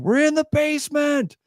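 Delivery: retold scream. Dad answering the neighbor from the basement, imitated scream. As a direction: imitate a dad calling back at a high pitch. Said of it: "my dad starts screaming".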